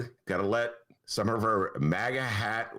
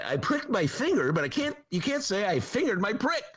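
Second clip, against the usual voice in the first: George Carlin imitation; 9 semitones higher than usual. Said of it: "that's my George Carlin imitation".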